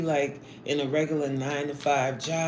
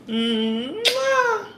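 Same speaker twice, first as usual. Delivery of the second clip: high playful kiss sound; air kiss; 4 dB louder than usual. Real, not acted. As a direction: blow a loud smooch to the viewer. Blowing a kiss to viewer.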